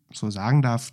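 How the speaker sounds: ordinary delivery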